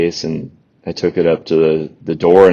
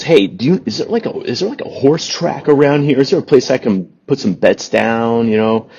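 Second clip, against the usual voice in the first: higher-pitched imitation of a New York stranger; New York accent; 6 semitones higher than usual. Retelling an odd passenger asking about betting, lightly imitated New York accent. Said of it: "from New York or something like that".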